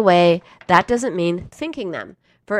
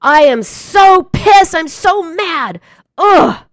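loud, slow, higher exasperated outburst ending in a groan; venting anger; 9 semitones higher, 11 dB louder, slower than usual. Shown as a demonstration. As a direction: let the anger out full force, then groan. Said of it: "this is how you express it".

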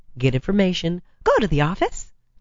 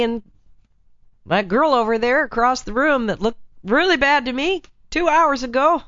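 lightly voiced bar-goer, pitched up; man at closing time; 6 semitones higher than usual. Man at a closing club sizing up a girl, lightly acted. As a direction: play a late-night club-goer talking himself into it.

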